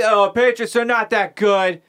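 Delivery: badly faked Boston accent; mock Boston accent. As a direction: do a hammed-up Boston complainer. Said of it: "in a Boston accent".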